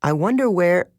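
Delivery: put-on curious teen girl, musing; Annabeth's voice. A narrator's reading. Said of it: "Annabeth started to say".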